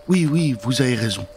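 usual speaking voice

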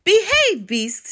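high, loud first word, then dropping; mock shout of a storybook hero; heroic shout. A narrator's reading. Goat Avenger scolding the giant, storybook shout. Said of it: "shouted the Goat Avenger".

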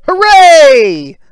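high, loud cheer. Acted. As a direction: celebrate big. Cheer for flare.

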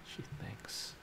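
hushed unvoiced aside; quiet conspiratorial whisper. Real, not acted. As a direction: whisper it close to the mic.

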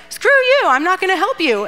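high, loud opening, easing off; hammed-up rude brush-off, pitched high; mock defiant refusal. Nabal refusing David's servants, mock defiant.

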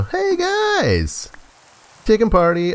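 squeaky high hello to the chickens; high greeting voice. Acted. Greeting the chickens in a high squeaky voice. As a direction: pitch way up and chirp it at them.

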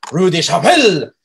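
loud, exaggerated French pronunciation; hammed-up French accent, punching Chapelle. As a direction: mimic a French driver saying the street name with relish. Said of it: "I didn't have the Chapelle".

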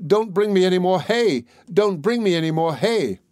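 high-pitched storybook cry of protest. A narrator's reading. Tom shouts from inside the cow's stomach, crying out. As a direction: call out high, pleading for it to stop. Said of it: "cried out as loud as he could".

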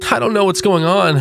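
frightened pleading. Acted. Same frightened man continues.

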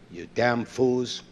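normal talking voice

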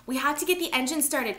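high, loud urging; urgent cry. A narrator's reading. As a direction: call out urgently. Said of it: "Papi cried".

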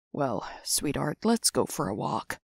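soft, low, kindly invitation; gentle. Acted. Mister Chuck comforting sweetheart, soft and low.

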